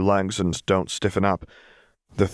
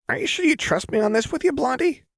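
very high, fast manic tease; unhinged playful girl; Jinx voice. Acted.

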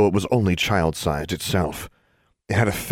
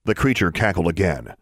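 ordinary delivery, nothing marked

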